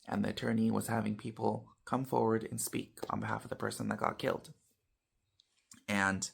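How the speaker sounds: usual speaking voice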